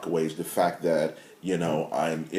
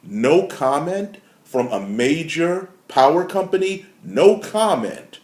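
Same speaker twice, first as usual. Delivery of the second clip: 1 outraged; 8 semitones higher, 6 dB louder than usual. Real, not acted. Outraged at the company's reply.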